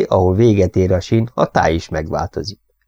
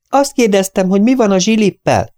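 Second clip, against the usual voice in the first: insistent shout; 13 semitones higher than usual. Acted. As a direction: demand an answer across the cart. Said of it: "kiáltotta újra Molly".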